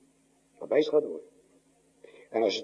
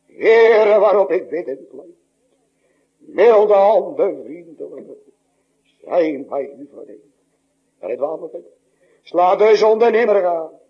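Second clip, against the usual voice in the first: psalm recited as prayer; 4 dB louder, slower than usual. Acted.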